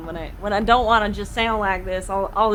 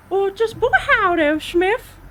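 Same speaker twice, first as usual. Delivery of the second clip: much higher cartoon mouse falsetto; a very weird high cartoon mouse voice; Mickey Mouse; 11 semitones higher, 4 dB louder than usual. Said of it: "Very weird Mickey Mouse".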